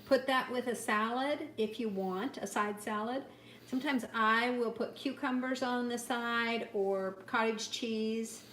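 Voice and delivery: ordinary talk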